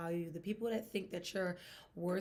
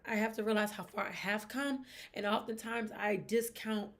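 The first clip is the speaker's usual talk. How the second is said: ordinary delivery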